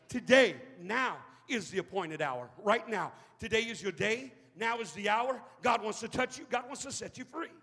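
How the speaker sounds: loud fervent preaching; preaching at full force. Acted. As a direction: hammer it home to the congregation. Preaching climax.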